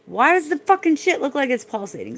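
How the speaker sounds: high, loud outburst of disgust; yelling at the pulsating skin in alarm; real yell. Real, not acted. Streamer yells at the camera over pulsating skin, loud and high. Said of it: "Sorry if I just yelled in your ears".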